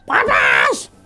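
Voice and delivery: high-pitched imitated scream; mock scream, no great volume; retold shriek. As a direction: cry out like the possessed mother in pain. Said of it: "sambil terus berteriak".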